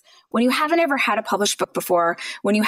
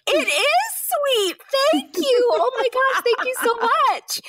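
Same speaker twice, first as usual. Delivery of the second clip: very high, slow, gushing thanks; gushing naive self. Retold or imitated. Author imitating her naive self thanking publishers, high and gushing.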